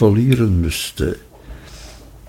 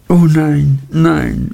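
higher-pitched, lightly tearful denial; hint at a sob, voice lifted; mild sobbing; 5 semitones higher, slower than usual. A narrator's reading. Dora reassures her tender suitor, mildly sobbing. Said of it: "schluchzte Dora".

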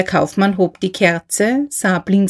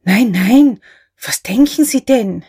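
frightened protest; 3 semitones higher, 4 dB louder than usual. A narrator's reading. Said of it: "in erschrockener Abwehr".

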